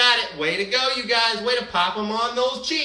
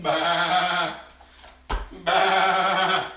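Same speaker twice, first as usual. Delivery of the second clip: sheep baa. Pretend. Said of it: "baa like a sheep".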